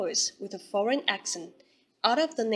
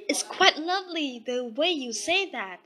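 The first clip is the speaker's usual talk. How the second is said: crying out; 5 semitones higher than usual. A narrator's reading. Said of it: "cried Daisy".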